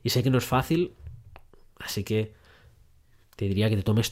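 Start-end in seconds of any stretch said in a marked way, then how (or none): 1.8-2.3 whispered aside. Real, not acted.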